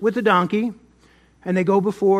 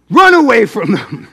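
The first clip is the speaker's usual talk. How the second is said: sudden loud burst; loud, urgent exclamation; shouted punch line; 2 semitones higher, 8 dB louder than usual. Shown as a demonstration. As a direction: belt it out as a comic warning. Loud punch line after quiet setup.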